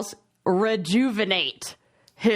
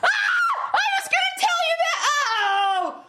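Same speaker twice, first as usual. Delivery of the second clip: excited shout. Real, not acted. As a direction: shriek it out, caught by surprise. Forgot to reveal his name.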